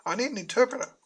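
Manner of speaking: very high, urgent dog's cry for help; a dog shrieking high for help; frantic dog voice. Acted. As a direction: squeal it like a panicking dog. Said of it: "like she was".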